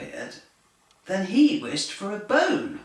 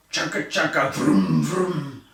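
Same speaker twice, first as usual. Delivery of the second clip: chugging engine sounds, lower; tractor noises; 3 semitones lower, 4 dB louder than usual. A narrator's reading. Reading a story to children, a loud tractor chugging. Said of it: "It was a very loud tractor".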